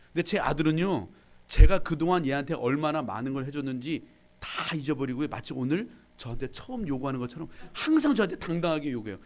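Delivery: ordinary talk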